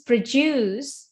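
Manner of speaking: produce drawn out with stress on "duce"; slow model reading, lean on the end of produce; second-syllable stress. Shown as a demonstration. Teacher modelling the verb for students, produce stressed on the second syllable. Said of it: "jadi second syllable".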